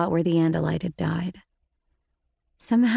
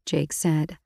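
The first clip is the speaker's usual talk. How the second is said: plain reading voice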